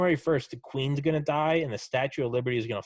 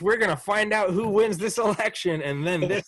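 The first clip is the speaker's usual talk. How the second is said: mock excited confidence; 7 semitones higher than usual. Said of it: "I'm like".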